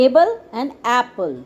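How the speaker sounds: "able" with long ay, "apple" with short a, slowly; contrast the A sounds. Shown as a demonstration. Teacher contrasts "able" and "apple" for pupils, slow and clear. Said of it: "ਦੋਨੋਂ ਦੇ sound ਬਿਲਕੁਲ ਅਲੱਗ".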